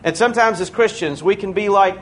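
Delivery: ordinary delivery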